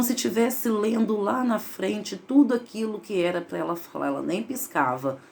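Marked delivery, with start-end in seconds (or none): none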